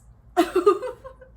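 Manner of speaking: high loud burst of laughter. Real, not acted. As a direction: break into laughter. Laughing after shock.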